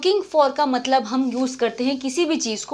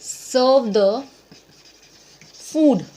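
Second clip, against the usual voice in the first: word by word, louder at the end; slow dictation; 3 dB louder, much slower than usual. Shown as a demonstration. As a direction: drill it for the class. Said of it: "बोलना हो तो क्या बोलोगे?". (धीरे, आख़िरी शब्द ज़ोर से)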